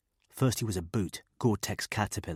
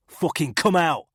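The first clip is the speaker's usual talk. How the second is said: shouted order; 10 semitones higher, 8 dB louder than usual. A narrator's reading. Gardner orders hidden man out.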